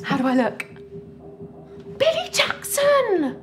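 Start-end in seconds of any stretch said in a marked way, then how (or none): 2.0-3.4 high, slow thrilled gasp of a name; starstruck. Acted. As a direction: gush over the celebrity. Starstruck over a celebrity.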